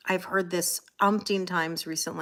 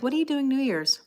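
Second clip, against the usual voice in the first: higher-pitched imitation of people asking; mimicked eager question; 5 semitones higher than usual. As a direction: imitate everyone's chirpy holiday small talk. Repeats the mimicked holiday question.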